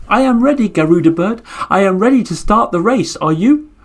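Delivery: high, perky turtle voice; ready little turtle. Acted. Turtle telling the Garuda bird it is ready to race, high and perky. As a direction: chirp your readiness.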